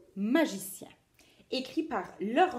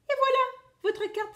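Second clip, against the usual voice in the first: very high proud bunny cry; squeaky rabbit exclaiming; 6 dB louder, faster than usual. Acted. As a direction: show off the trick triumphantly. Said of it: "s'écrie-t-il".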